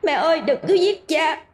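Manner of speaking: put-on high child's voice begging; a small boy pleading, slowing at the end. Son begging his mother to spare his father, high pleading child. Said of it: "Một giọng quen thuộc cất lên".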